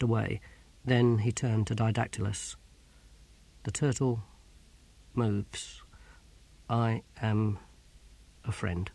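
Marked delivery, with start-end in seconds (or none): 3.6-5.8 soft, halting, confidential slogan; hushed, deliberate recognition phrase, pausing midway; secret password. Acted. 6.7-8.9 quiet confiding. Acted. Sergeant quietly reveals himself as ally.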